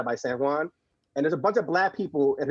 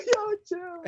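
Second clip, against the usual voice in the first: laughing; 4 dB quieter than usual. Real, not acted. Laughing at the impression.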